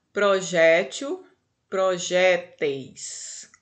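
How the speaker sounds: slow "Projétil, projéteis", leaning on the jé; stress on penultimate syllable. Shown as a demonstration. Teacher showing where the tônica stays, slow stressed jé in "Projétil, projéteis". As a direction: draw out each word slowly, stressing jé in "Projétil, projéteis". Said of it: "a tônica tá na penúltima".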